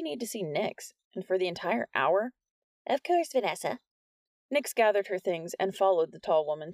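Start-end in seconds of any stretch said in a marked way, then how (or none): 2.9-3.8 higher, light teacher voice; lightly voiced courteous teacher; a touch of polite teacher. A narrator's reading.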